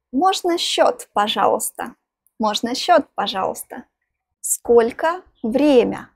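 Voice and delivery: ordinary talk, nothing marked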